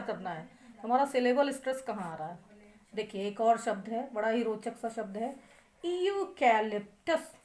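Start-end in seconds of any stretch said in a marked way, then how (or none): 5.8-7.3 यूकैलिप्टस stretched slowly as ee-yoo, ka, lip, tus; higher, drawn-out teacher's demonstration of यूकैलिप्टस; correct pronunciation, syllable by syllable. Shown as a demonstration. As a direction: sound out यूकैलिप्टस in slow, separate syllables. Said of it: "सही, सही क्या है pronunciation".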